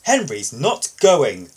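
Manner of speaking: defiant. Acted. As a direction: dig your heels in. Gordon defiant refusal.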